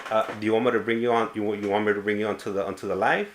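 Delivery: ordinary talk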